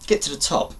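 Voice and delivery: normal talking voice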